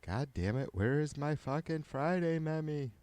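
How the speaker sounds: ordinary talk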